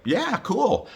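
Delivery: high-pitched imitation of the wife; wife's reply. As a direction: retell her reply way up high. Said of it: "And she's like".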